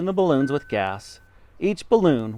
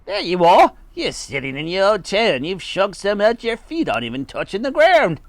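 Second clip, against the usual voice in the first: raised, higher accusing shout; 7 semitones higher, 4 dB louder than usual. A narrator's reading. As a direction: shout it at her in triumph. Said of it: "pointed at her and shouted".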